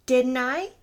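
sudden loud demanding repeat. Acted. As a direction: snap the question out loud. Repeats the question, much louder.

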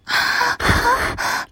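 high, breathy panting gasps; heavy gasping. Pretend. Lover breathless while pleading, gasping. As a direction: gasp for air between pleas. Gasp within erotic roleplay.

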